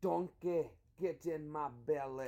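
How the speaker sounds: mock Scottish Shrek voice; Scottish accent. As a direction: put on a broad Scottish accent. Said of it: "Try a Scottish accent. Think Shrek".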